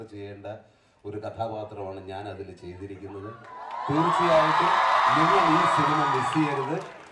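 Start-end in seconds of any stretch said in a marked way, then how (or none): 3.9-7.0 loud, high appeal to the crowd; emphatic appeal. Real, not acted. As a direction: urge them at full volume. Urging crowd to see film. (ഉറക്കെ ഊന്നിപ്പറഞ്ഞ്)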